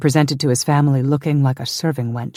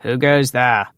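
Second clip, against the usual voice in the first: lightly voiced wall guard's challenge; demanding sentry. A narrator's reading. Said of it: "demanded a faceless voice".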